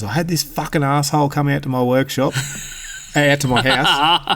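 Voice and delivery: put-on high, ranting old man quote; angry old bloke. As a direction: mimic a furious pensioner cursing the mechanic. Old man ranting about mechanic.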